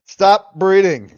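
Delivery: loud, slow mock command; hammed-up stern order. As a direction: bark it out slowly as a joke.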